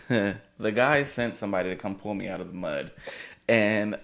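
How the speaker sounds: usual speaking voice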